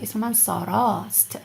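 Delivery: ساراست with the final آ stretched a little; lengthened vowel. Shown as a demonstration. Teacher demonstrates the longer vowel in ساراست for students. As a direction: draw out the آ in ساراست. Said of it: "just make it a little bit longer".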